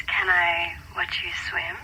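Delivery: slow, teasing hushed question; a slow, playful whispered dare; flirty whisper. Acted. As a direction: tempt him in a slow whisper. Flirting over video call.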